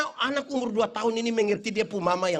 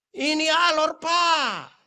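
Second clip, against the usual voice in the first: loud high shout of pride; rally the crowd; shouted cheer; 7 semitones higher, 5 dB louder, much slower than usual. Real, not acted. (berteriak bangga kepada jemaat)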